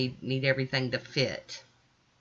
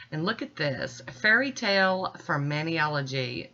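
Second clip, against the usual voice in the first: usual speaking voice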